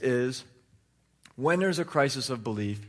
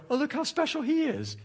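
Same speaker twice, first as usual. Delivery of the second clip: mock gushing; 12 semitones higher than usual. Mocking crowd that praises you.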